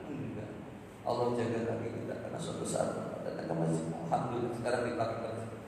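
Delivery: ordinary delivery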